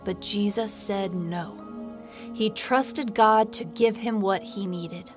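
ordinary delivery

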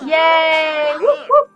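high, loud party cheer. Real, not acted. Cheering birthday candles.

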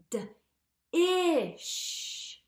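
D-ish stretched with the d split off, slow and drawn; segmenting D-ish slowly; sounding out. Shown as a demonstration. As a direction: draw out D-ish so kids hear the sh at the end. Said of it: "Where do we hear that digraph?".

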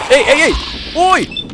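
high, loud yelps of alarm; startled. Real, not acted. Gamer suddenly under fire, startled yelps. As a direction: yelp as if caught off guard.

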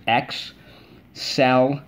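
"Excel-lent" split slowly into Ex-cel-lent; syllable by syllable. Shown as a demonstration. Teacher to class, tapping syllables slowly on fingers. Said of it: "Take your fingers".

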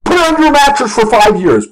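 high, urgent TV-pundit shouting; hammed-up alarmed market guru; James Cramer. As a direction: warn the viewers in a mock panic. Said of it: "This was James Cramer coming on T V".

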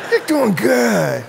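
high, drawn-out, gushing encouragement; suddenly pastorly. Acted. Irritated pastor switches to praising the new cashier, gushing encouragement. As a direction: swoop up into over-sweet praise. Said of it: "go from being jerk to pastor".

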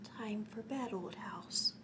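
soft unvoiced whisper; whispering goodnight to the old house while Grandpop sleeps. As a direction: breathe it so nobody wakes. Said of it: "gently closed the shade and whispered".

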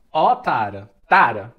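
ó Tara, Tara called loud and long; calling out to Tara. Shown as a demonstration. Teacher shouting Tara to show the vocative. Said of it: "dá um grito assim".

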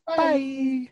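very high sing-song bye; Valley Girl bye. Retold or imitated. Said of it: "it would say".

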